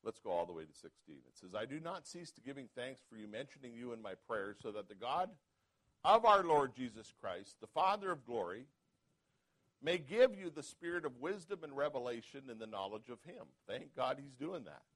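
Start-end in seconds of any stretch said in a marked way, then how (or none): 1.5-13.5 rising to a loud preaching peak on the Lord's name; scripture reading. Acted. Pastor reading Ephesians to the church, preaching. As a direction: read the verses as a building sermon.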